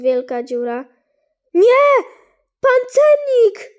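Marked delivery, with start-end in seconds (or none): 1.5-1.9 sudden loud cry of alarm; alarmed shout. Real, not acted. Gamer shouting as pet drops. 2.6-3.7 high, slow, loud cry for the armadillo; alarmed cry. Real, not acted. Gamer crying out to his lost armadillo.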